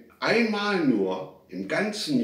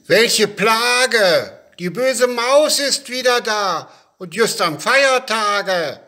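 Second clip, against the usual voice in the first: loud, high cry of complaint; exasperated shout; 10 semitones higher, 9 dB louder than usual. A narrator's reading. Father and boys lament the mouse, shouting in exasperation. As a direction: call out the outrage at full volume. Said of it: "die riefen".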